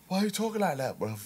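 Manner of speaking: a puzzled local asking lightly; mock puzzled local. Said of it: "he was just looking at me like".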